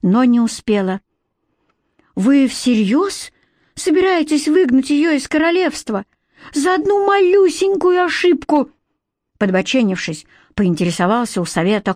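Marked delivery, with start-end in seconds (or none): 2.2-8.7 incredulous, climbing ever higher; indignant boy. A narrator's reading. Boy challenging the council over banishment, indignant. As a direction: protest high, building to outrage.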